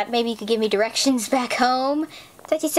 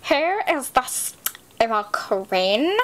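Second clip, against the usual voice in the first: ordinary delivery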